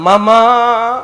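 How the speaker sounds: loud, drawn-out mock wail; tangisan makin kuat. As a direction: wail it out like a grieving husband putting on a show. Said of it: "makin kuat nangisnya". (tangisan keras dibuat-buat)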